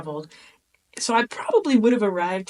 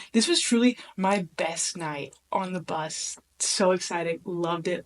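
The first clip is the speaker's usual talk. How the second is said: ordinary delivery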